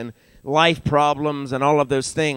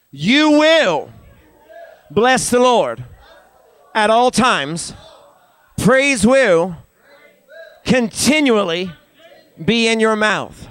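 high, forceful telling-off, phrase by phrase; emphatic near-yell with attitude; 6 semitones higher, 4 dB louder, slower than usual. Shown as a demonstration. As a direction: order them, as if about to yell. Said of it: "as if you're about ready to yell at 'em".

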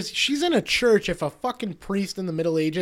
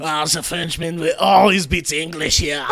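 cartoonish French accent, getting louder; 5 dB louder than usual. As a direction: brag in a hammy French accent. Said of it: "Like that kind of French".

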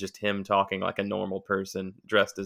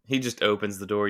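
normal talking voice

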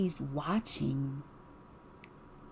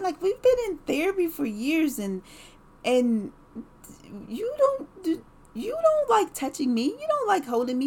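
high, pleading disbelief, louder at times; exasperated pleading; 9 semitones higher, 4 dB louder than usual. Retold or imitated. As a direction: pour out hurt confusion at him. She confronts husband, exasperated.

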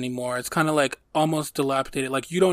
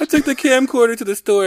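much higher and louder repeat of the punchline; excited; 9 semitones higher, 7 dB louder than usual. Real, not acted. Retelling the camcorder punchline to a friend, high excited voice. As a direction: lean in hard on the reveal.

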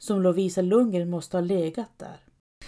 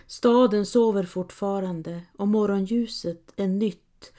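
ordinary delivery, nothing marked

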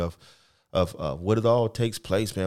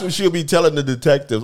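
usual speaking voice

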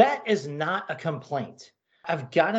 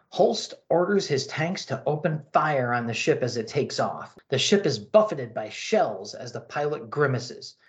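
ordinary talk, nothing marked

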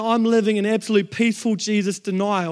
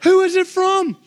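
higher, louder excited question; eager asking; 10 semitones higher, 7 dB louder than usual. Retold or imitated. Couple asking who sent the money, loud and high. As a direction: burst out wanting to know. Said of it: "we're like".

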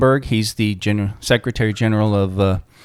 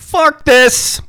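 much higher, loud comic outburst; Bolivian gimp on fire; 8 dB louder than usual. Pretend. Answering the accent challenge, Bolivian gimp on fire. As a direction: yell it like a man on fire. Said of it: "accent of a Bolivian gimp on fire".

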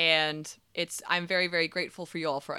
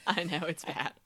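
hoarse; 2 semitones higher, 8 dB louder than usual. Real, not acted. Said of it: "you're hoarse, girlfriend".

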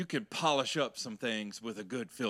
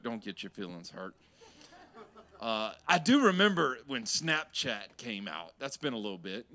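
ordinary talk

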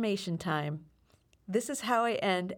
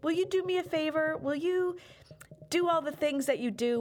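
higher, bright-energy request; eager sign-off plea; upbeat ask; 8 semitones higher than usual. Real, not acted. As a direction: sell the favor with enthusiasm.

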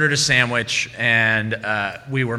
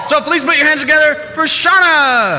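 much higher and louder hype; emcee announcement; 12 semitones higher, 3 dB louder than usual. Real, not acted. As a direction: pump up the crowd for her entrance. Host introduces Shauna to audience.

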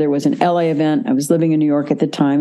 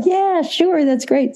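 much higher, imitating an eager yes; mock-eager, pitched way up like a thrilled kid; eager acceptance; 12 semitones higher, slower than usual. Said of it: "He's like".

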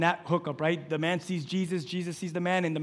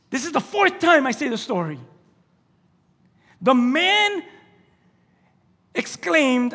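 loud, high fiery preaching; preaching at full force; 9 semitones higher, 10 dB louder than usual. Acted. Pastor preaching the healed blind man story, fiery and loud.

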